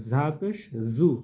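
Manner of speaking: slow, loud buzzing z in 'ঝা পেশ জু'; cricket-like buzz. Shown as a demonstration. Teacher models za pesh for learners, z buzzing like a cricket. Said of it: "এটা সামান্য ঝিঝি পোকার মতো আওয়াজ দেবেন".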